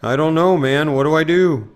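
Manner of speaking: hammed-up dude panic; mock dude voice. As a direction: whine like a lazy slacker. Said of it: "Slowpoke Rodriguez impression".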